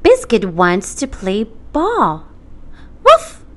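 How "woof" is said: high squeaky puppy woofs; puppy bark. Acted. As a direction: yip like a little puppy. Puppy barks.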